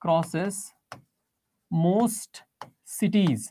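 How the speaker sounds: each word alone, long pauses between; word-by-word dictation. Real, not acted. Teacher writing out a note for students, dictating word by word. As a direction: say it slowly, one word at a time.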